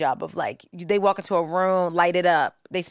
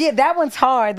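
laughing; 6 semitones higher, 6 dB louder than usual. Real, not acted. Laughing at the failed word.

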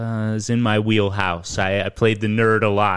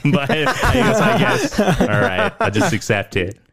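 laughing; 9 semitones higher, slower than usual. Real, not acted. Laughing while admitting eccentricity.

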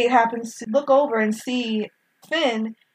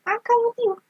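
high, chirpy little robot agreeing; cute droid voice; 13 semitones higher than usual. Retold or imitated. Host voicing droid BB-8 agreeing to follow Rey, chirpy high robot voice. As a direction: play the cute beeping droid giving in. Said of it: "It's like".

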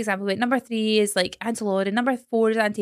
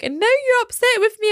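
higher, louder imitation of a crying little boy; whiny sobbing kid, hammed up; mock crying child; 14 semitones higher, 5 dB louder than usual. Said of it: "he starts crying".

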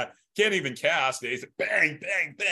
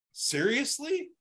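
incredulous; 12 semitones higher, slower than usual. Real, not acted. Exasperated at dad's luck.

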